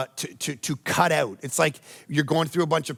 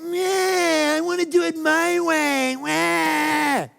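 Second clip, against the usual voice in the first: put-on a crying, much higher kid's whine; whiny child; 11 semitones higher, much slower than usual. Pastor voicing believers whining back at God like a child. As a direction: wail it like a sulking toddler. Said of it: "We go".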